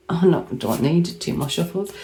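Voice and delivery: ordinary talk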